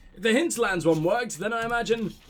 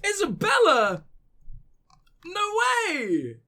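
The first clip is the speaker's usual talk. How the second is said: excited; 11 semitones higher than usual. Real, not acted. Recognizes the character.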